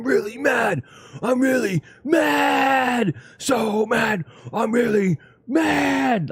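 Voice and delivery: high, slow, wailing shout; mock emo singer. Mocking emo singers to chat, slow wailing shout. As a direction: ham up a whiny emo vocalist. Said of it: "I like when they're like".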